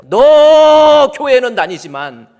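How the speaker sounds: high, loud and slow, shouting down a rebuke; Lord's stern rebuke. Acted. Preacher voicing the Lord rebuking a pastor, loud and slow shout. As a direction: thunder the Lord's reproach at the congregation. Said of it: "우리 주님이 꾸짖는 내용이 뭐예요?".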